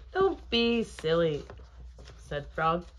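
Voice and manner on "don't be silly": high, loud storybook scolding; raised, emphatic chiding; playful chiding. A narrator's reading. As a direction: brush off a friend's fuss. Frog chides Toad.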